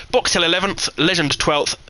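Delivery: ordinary talk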